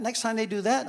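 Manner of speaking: ordinary talk, nothing marked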